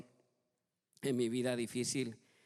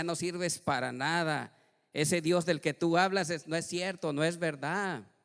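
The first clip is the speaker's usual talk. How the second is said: mocking inner voice; 5 semitones higher, 7 dB louder than usual. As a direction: sneer like a tempter. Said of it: "Venía una voz que me decía".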